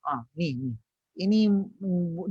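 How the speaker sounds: ordinary talk, nothing marked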